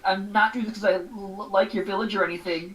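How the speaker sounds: lightly voiced flustered tsundere; tsundere villain. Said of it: "like a tsundere evil".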